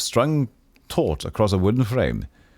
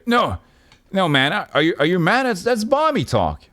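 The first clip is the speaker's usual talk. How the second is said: horrified; 11 semitones higher than usual. Acted. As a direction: leap in panicked, voice jumping up.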